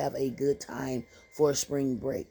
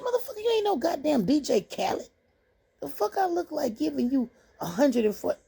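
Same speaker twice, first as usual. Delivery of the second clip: 1 mock outrage; 8 semitones higher than usual. Furious at the DJ's price.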